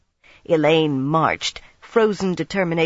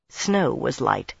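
ordinary delivery, nothing marked

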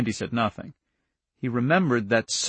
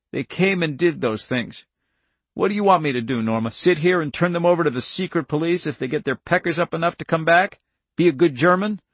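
higher, louder challenging questions; indignant countryman; 6 semitones higher, 4 dB louder than usual. Acted. Irv challenges his wife Norma, indignant. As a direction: throw the questions at her, affronted.

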